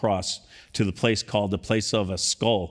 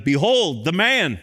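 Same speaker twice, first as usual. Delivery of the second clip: louder, raised proclamation; proclaiming; 8 semitones higher, 5 dB louder, slower than usual. Acted. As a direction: present him to the crowd. Pilate presents Jesus to crowd.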